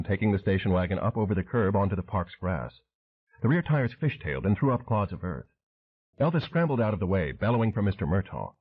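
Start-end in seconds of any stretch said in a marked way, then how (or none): none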